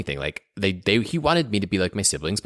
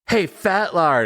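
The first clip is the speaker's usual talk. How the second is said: taunting little brother; 10 semitones higher, 5 dB louder, much slower than usual. Retold or imitated.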